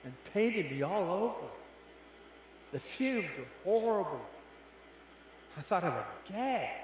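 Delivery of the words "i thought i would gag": normal talking voice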